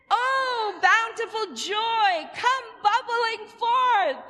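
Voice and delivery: high, slow, loud chant of joy; joyful exclamation. Shown as a demonstration. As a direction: call it out big and joyful.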